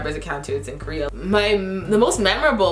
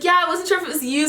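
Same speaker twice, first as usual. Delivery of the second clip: much higher sheepish fan voice; high bashful fan imitation; shy fan; 10 semitones higher, faster than usual. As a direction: admit it bashfully.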